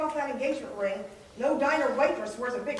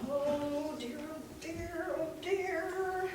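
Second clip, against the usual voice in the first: hushed anxious oh dear under breath; worried muttering; 3 semitones higher, 10 dB quieter, much slower than usual. Acted. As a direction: fret quietly to yourself. Waitress panics under her breath.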